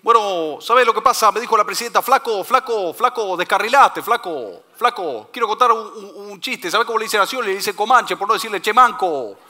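high, loud put-on politician voice; Randazzo. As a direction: imitate a politician struggling through stand-up, loud and high. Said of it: "Y Randazzo ahí".

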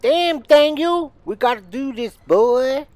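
high, loud, drawn-out holler; hyped-up catchphrase yell. Pretend. Gamer geeing himself up to play on, hyped-up yell. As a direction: call it out high and stretch it.

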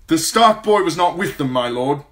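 loud, high, hurried messenger knight; urgent knight's report. A narrator's reading. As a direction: report from horseback in a rush. Sir Adam reporting urgently.

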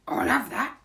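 gleeful burglar claiming loot; burglar character. A narrator's reading. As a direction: grab it like a keen burglar. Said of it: "said Burglar Bill".

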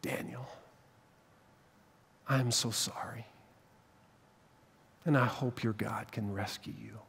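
hushed, breaking whisper of a grieving king; sorrowful whisper. Acted. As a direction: whisper it like a last goodbye at the pit. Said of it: "he said one last time".

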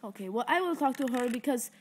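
ordinary talk

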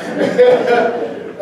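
bright burst of laughter. Real, not acted. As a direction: laugh out loud at the mixup. Laughs at title mixup.

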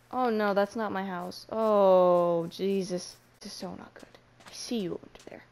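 ordinary delivery

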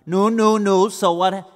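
exasperated sages; impatient interruption. Acted. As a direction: cut him off impatiently.

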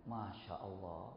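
slow, low, very soft; hushed awe. Real, not acted. Preacher marvelling at the son's remorse, hushed. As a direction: murmur it slowly in wonder.

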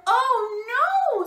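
high, loud mouse cry; alarmed little mouse. A narrator's reading. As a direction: cry out as a worried tiny mouse. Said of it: "Little Mouse came back".

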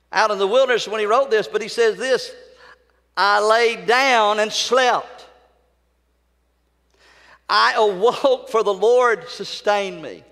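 stretched pulpit declamation; full-force preaching. Real, not acted.

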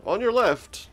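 high, loud tour guide patter; chirpy guide pointing out the sights. Pretend. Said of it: "Does it do, like, a tour guide voice".

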